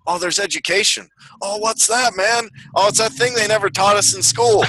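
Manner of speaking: high-pitched mock wonder; amazed viewers. Acting out future viewers' amazed discovery.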